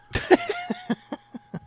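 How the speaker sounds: a burst of high-pitched laughter; real amused laugh. Real, not acted. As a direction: cackle at your own jab. Host laughs at own joke.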